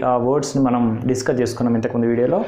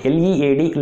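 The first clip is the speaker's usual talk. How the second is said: ordinary talk, nothing marked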